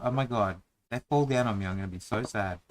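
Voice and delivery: usual speaking voice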